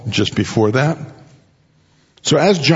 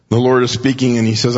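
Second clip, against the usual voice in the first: normal talking voice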